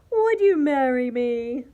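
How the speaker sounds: loud, stretched-out proposal; bold rooster suitor. A narrator's reading. Rooster proposing to the cockroach, loud and showy. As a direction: crow the question boldly.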